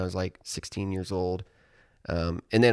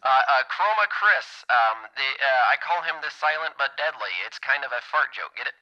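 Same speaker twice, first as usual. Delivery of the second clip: put-on comedian voice on a phone line; Tony Hinchcliffe; 3 semitones higher, 3 dB louder than usual. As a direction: prank caller pleased with a silly joke. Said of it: "is this my buddy Tony Hinchcliffe?".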